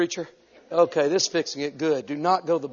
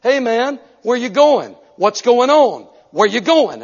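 loud, high friendly hey-man voice; hammed-up chummy stranger; mocking friendly guy; 5 semitones higher, 7 dB louder than usual. Preacher mimicking the Harvard man's chummy greeting. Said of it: "He opened up his mouth and said".

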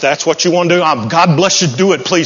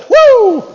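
high loud whoop; joyful whoop; 5 dB louder than usual. Real, not acted. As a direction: let out a joyful shout. Preacher whoops.

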